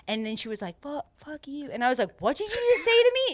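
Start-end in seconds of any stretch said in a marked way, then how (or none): 0.8-1.7 very soft, slightly higher retold curse; mad friend. Tattler retelling friend Emma's cursing, softly mock-mad. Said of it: "so mad at me". 2.2-3.3 high, quick retold challenge; mock indignant. Tattler confronting cursing friend in retelling, mock indignant.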